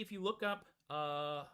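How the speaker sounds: ordinary talk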